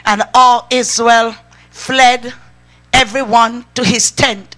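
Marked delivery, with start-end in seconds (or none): none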